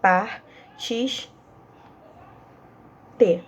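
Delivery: "Par, tis, te" said as separate beats; syllables split apart. Shown as a demonstration. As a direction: break "partiste" into "Par", "tis", "te". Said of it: "olha só, não sei se vocês repararam". ("Par, tis, te" sílaba por sílaba)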